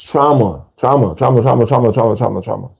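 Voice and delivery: quick, running repeats with no breaks; rapid repetition. Real, not acted. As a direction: rattle it off like a chant. Hammers one word over and over.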